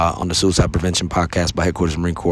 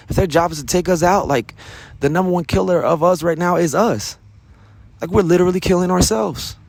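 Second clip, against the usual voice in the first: rising urgency, pleading with the troops; impassioned plea; 10 semitones higher than usual. Real, not acted. Urgent plea about military suicides.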